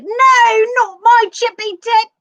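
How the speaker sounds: high shrieking British husband; loud squeal in a British voice; squealing British man. Retold or imitated. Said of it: "the guy's like".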